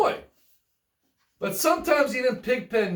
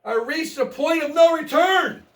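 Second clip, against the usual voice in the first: yelled; 7 semitones higher, 4 dB louder than usual. Acted. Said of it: "he yelled".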